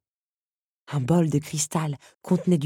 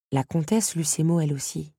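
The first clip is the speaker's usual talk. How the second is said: plain reading voice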